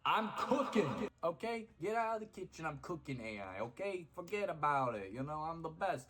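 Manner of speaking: high, loud hammed-up Boston accent. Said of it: "with the Boston accent".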